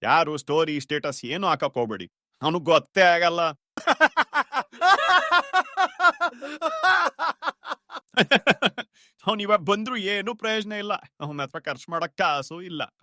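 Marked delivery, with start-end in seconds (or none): 3.8-8.8 mocking laugh. Friends mocking jobless friend.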